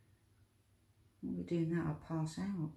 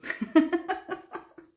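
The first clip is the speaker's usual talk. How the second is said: burst of laughter; 13 semitones higher, 9 dB louder than usual. Real, not acted. Laughs at own joke about pink.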